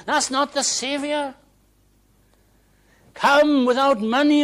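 high, shouted gospel proclamation; preaching at full force. Real, not acted. Preacher urging sinners to come as they are, shouted preaching. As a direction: cry it out to the whole congregation.

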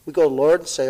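ordinary talk

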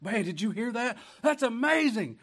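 mock amazement. Preacher voicing an excited listener, high and loud. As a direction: act out being thrilled by the news.

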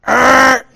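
loud angry grunt; frustrated growl. Retold or imitated. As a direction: let the anger boil out in a grunt. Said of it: "I'm starting to boil".

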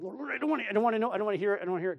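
put-on high, pleading whine of a kid refusing; whiny protest. Pastor voicing himself protesting to the Lord, high and whiny. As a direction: beg the Lord not to make you listen.

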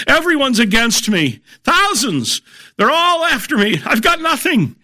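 high-pitched frantic overwhelm; mock panic. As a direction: play up David's alarm, pitched very high. Voices David's despair before his confidence.

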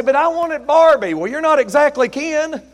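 high, loud comic bickering; mock complaint and retort. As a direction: holler it like a joking marital gripe. Mock spouse's complaint and preacher's retort.

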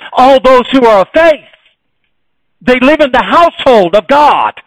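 loud, high, driving sermon delivery; preaching at full force. Acted. Preacher rousing his church about loving all believers, loud and fervent. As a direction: hammer every line home to the congregation.

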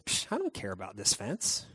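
low, soft, offhand brush-off; dismissive scoff. Acted. God brushing off a man-made rule, dismissive. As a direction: wave it off casually. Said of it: "God just shows up and says".

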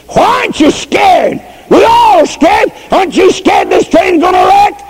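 high, frantic cry of fear; old woman shaking the girl on the swaying train, frantic; panicked old woman. Retold or imitated. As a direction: shake the child and plead, terrified.

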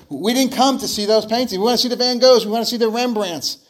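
an impatient bidder demanding the masters; angry. Acted. At the auction, a bidder angrily demands the famous paintings. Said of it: "Another voice angrily said".

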